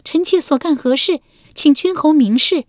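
high, forced defiance; defiant court lady. A narrator's reading. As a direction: brace herself and demand. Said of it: "文姜硬着头皮道". (文姜硬着头皮高声质问)

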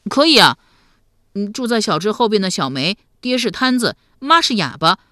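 put-on loud, lively boyish telling; eager youngster spilling the story; artless young nephew. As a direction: rattle it off like a frank lad.

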